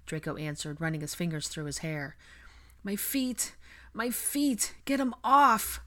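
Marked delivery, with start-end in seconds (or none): 2.8-5.8 high, strained delirious plea, building to loud; panting distress. A narrator's reading. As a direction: beg in rising panic. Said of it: "Blaze panted".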